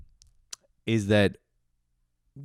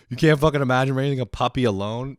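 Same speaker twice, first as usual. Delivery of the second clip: louder, higher incredulous repeat; 6 semitones higher, 8 dB louder than usual. Retold or imitated. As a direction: throw the words back in disbelief. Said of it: "I was like".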